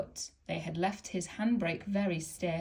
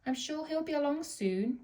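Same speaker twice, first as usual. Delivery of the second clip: lightly voiced coaches; 8 semitones higher than usual. A narrator's reading.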